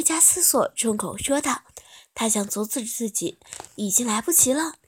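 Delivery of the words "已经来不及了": plain reading voice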